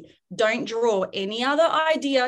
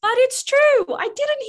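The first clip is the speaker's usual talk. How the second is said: excited; 8 dB louder than usual. Real, not acted. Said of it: "emphatic aside".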